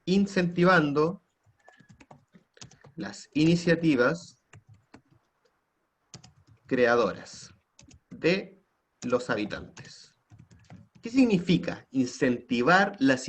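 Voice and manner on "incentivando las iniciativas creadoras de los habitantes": word by word with long pauses; slow dictation. Real, not acted. Teacher giving students the next objective to copy, slow dictation. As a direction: dictate it slowly, piece by piece.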